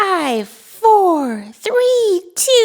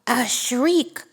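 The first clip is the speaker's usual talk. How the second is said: ordinary delivery, nothing marked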